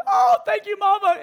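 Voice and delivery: very high grateful chick voice; a tiny eaglet squeaking thanks; squeaky baby voice. Retold or imitated. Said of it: "they're going".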